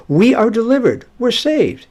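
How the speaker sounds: high, loud cry of deliverance; mock exultant claim. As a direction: crow it like people sure of their safety. Voicing the people's false confidence.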